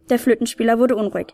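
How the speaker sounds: ordinary delivery, nothing marked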